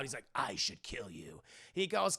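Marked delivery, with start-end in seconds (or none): 0.3-1.4 very low, soft threat; low mock-villain menace; menacing Electro. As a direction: drop deep into a quiet villain voice. Electro threatens his would-be rescuer.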